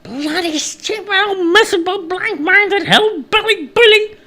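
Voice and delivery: high-pitched comic cursing. A narrator's reading. Stranger cursing the woodcutter as he walks away, cursing. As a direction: rattle off insults in a high character voice. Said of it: "cursing under his breath".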